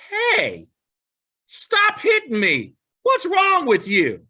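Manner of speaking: high-pitched, hammed-up protest; donkey's indignant shout. Donkey protests to the old man beating it, high indignant mock shout.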